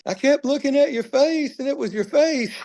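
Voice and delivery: very high, mock-tearful voice; imitating bawling mom. Retelling mom's words at the surprise reunion, imitated bawling. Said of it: "She started bawling".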